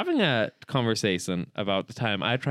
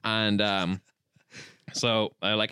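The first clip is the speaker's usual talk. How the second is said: normal talking voice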